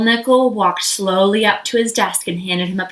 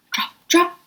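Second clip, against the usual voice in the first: ordinary delivery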